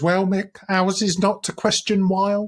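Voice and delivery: high Essex mum voice quoting Pam; imitating Pam. Podcaster quoting Pam's malapropism to his co-host, in a high mock Essex voice. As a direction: mangle the phrase proudly as Pam. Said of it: "and she says".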